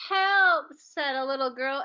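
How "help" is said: calling for help. Acted. Child overcome by smell.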